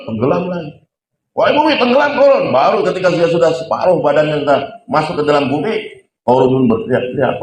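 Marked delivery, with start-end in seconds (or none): 1.4-2.4 commanding shout. Acted. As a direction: order the ground to swallow him. Musa commands the earth. (perintah lantang kepada bumi)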